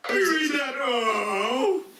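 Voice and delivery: panicked Homer voice. Acted. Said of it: "panicked, loud Homer".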